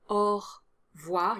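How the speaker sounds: au revoir in two syllables, no E after the R; French au revoir. Shown as a demonstration. Teacher models au revoir for learners, the E dropped. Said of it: "Definitely drop the E sound after the R".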